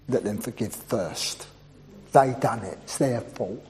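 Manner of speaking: a petty, hushed grumble of excuses; grudging mutter. Retold or imitated. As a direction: mutter it like someone who won't back down. Mocking the grudging churchgoer's voice.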